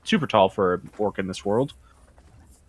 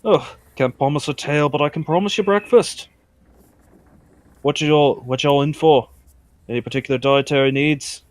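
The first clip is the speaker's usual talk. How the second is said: lightly voiced friendly tavern owner; orcish innkeeper; 3 semitones higher than usual. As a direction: take the order as a folksy innkeeper.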